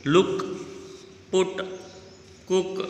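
"लुक, पुट, कुक" with a short, quick u. Shown as a demonstration. Teacher models words for pupils to repeat, short u in "लुक, पुट, कुक". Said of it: "छोटे ओ का sound आ रहा है".

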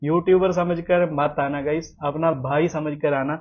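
usual speaking voice

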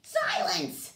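loud, high royal shout; barked command. A narrator's reading. Turtle king silences a complaining subject, barking. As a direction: bark it to cut the underling off. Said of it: "the king of the turtles barked back".

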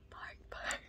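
voiceless little woof; breathy puppy bark; whispered bark. Pretend.

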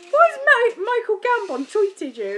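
baffled partner asking loudly; husband's disbelief. Retold or imitated. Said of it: "he went".

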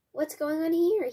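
high, loud demand. A narrator's reading. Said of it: "he demanded".

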